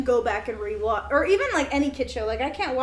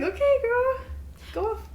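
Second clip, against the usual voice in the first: mock sassy, much higher pitch; 11 semitones higher than usual. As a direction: tease her with a cheeky sass. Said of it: "I saw that and I was like".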